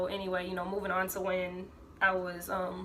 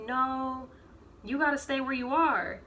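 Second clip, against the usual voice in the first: insistent mom; 6 semitones higher, 3 dB louder than usual. Retold or imitated. Mom insisting daughter not come home.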